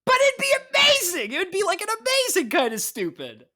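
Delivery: squealing high with delight; excited. Real, not acted. Gushing over a silly idea.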